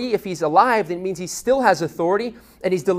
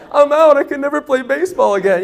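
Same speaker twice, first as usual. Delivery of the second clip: mock wail; 9 semitones higher, 9 dB louder than usual. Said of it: "I'm bawling, crying".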